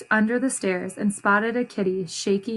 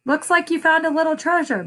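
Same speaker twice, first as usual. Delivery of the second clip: higher, gentle mother voice; Mama's voice; 7 semitones higher than usual. A narrator's reading. Mama admiring Esperanza's heart-shaped rock, lightly voiced. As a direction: lift it up as a kind mother. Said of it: "Mom said".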